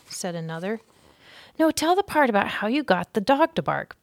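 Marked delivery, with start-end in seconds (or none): 1.6-4.0 higher, louder little cousin begging; pleading. A narrator's reading. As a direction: beg for the story like a small child. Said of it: "pleaded a third".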